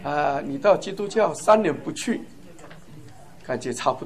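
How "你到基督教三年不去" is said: normal talking voice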